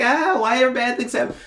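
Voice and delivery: high, whiny self-pitying act; hammed-up high lament; mock woe. Mocking self-saboteurs' woeful complaint.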